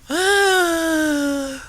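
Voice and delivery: a high, breathy gasp of horror; shocked gasp. A narrator's reading. Lorax sees goo dumped in the pond, gasping. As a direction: suck in air in shock.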